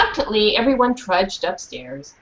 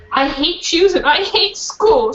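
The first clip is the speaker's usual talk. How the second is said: tearful complaint; 7 semitones higher than usual. Acted. Girl crying in closet.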